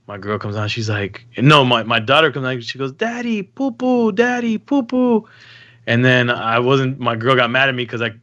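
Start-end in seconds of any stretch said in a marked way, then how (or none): 2.9-5.3 high-pitched imitation of a toddler calling out; little daughter's voice. Daughter announcing her news to dad, high imitated child voice. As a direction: mimic your small daughter, pitched way up.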